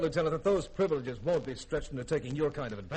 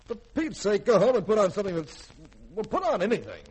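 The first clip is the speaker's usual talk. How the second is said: exasperated scolding; 8 semitones higher, 6 dB louder than usual. Acted. Commander exasperated with the girl.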